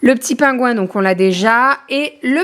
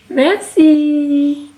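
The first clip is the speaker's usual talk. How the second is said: sing-song thanks; 3 semitones higher than usual. Real, not acted. Thanking the baby.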